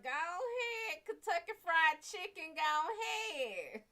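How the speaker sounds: high, loud, slow cheer of go ahead; egging herself on in a high, cheering squeal; high mock cheer.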